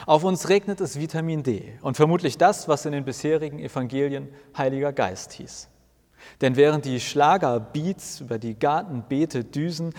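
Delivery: ordinary delivery, nothing marked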